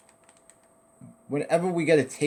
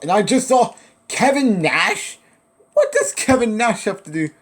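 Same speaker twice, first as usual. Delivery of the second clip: surprised; 7 semitones higher, 6 dB louder than usual. Real, not acted. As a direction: sound amazed at the sighting. Surprised seeing Kevin Nash.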